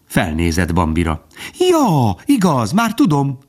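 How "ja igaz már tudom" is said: very high, excited squirrel; chattering. A narrator's reading. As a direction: burst out high as it comes back to you. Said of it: "fecsegett tovább". (fecsegő, izgatott, magas hangon)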